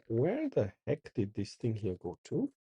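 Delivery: usual speaking voice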